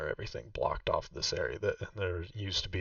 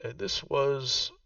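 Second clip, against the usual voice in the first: put-on a raised, slower voice for the old woman Vaku; game master voicing Vaku, noticeably higher; Vaku's voice; 6 semitones higher, slower than usual. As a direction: play the proud host showing her old home. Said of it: "She says".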